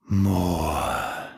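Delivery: hungry monster whisper; whispering creature. A narrator's reading. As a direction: breathe it with hunger. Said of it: "the creature whispered".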